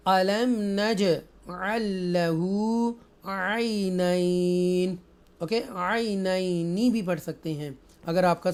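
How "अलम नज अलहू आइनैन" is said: slow, drawn-out tajweed chant; Quran recitation. Shown as a demonstration. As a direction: recite each word slowly and melodically.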